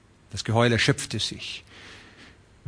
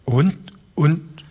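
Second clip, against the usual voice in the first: put-on halting old man's stammer; 5 semitones higher than usual. Old Broderson stammers to the ranchers, halting and raised. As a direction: stumble over the words. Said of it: "stammelte der alte Broderson".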